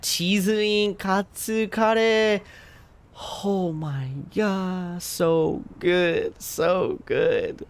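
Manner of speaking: high, slow, dreamy delight; swooning. Real, not acted. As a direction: melt over the food. Swooning over food.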